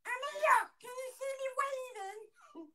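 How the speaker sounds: very high, squeaky little cry; baby voice. Acted. Hidden prank child calling to bloke, squeaky baby voice. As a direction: call out in a tiny piercing squeal. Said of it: "The, the baby voice".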